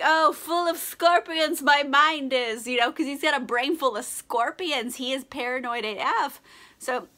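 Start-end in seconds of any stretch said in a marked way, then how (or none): 0.0-2.7 put-on slow, lightly theatrical old-stage reading; hammed-up Shakespeare. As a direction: ham up the Bard line slowly. Mock Shakespeare line from Macbeth.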